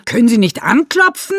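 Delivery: high, loud indignant yell; loud outraged shout at someone barging in; shouting angrily. A narrator's reading. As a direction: snap at the intruder at full volume. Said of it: "Renate schreit ihn an".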